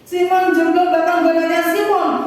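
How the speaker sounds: high, loud pulpit proclamation; forceful preaching. Acted. Preacher explaining Simon's astonishment to congregation, forceful preaching. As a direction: proclaim the amazement with full force.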